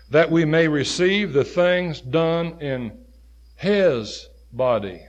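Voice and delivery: slow, leaning on "his body"; stress on his body. Shown as a demonstration. Preacher to congregation, stressing "his body" slowly. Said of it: "it makes a little difference when you emphasize that word".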